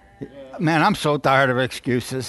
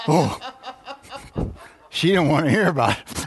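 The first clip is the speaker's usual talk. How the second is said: ordinary delivery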